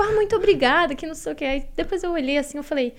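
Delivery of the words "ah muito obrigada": higher-pitched stock thank-you; standard polite thanks. Retold or imitated. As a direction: re-enact a run-of-the-mill polite thanks. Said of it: "eu fui muito padrão". (imitando um obrigada padrão)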